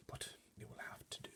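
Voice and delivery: a fussy butler's disapproving hush; whisper. A narrator's reading. Butler surveying furniture, whispering disapproval. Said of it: "he whispered".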